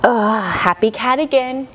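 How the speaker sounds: usual speaking voice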